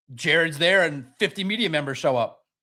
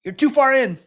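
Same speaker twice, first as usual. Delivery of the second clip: rising to a loud higher plea; pleading; 4 semitones higher, 6 dB louder than usual. Retold or imitated. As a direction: urgently tell him to pull back. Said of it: "He's like".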